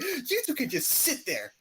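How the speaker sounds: high, slow scolding imitation; mock-pissed teacher. Teacher punishing two racing boys, imitated anger. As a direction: scold them in a drawn-out high voice. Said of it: "like, pissed".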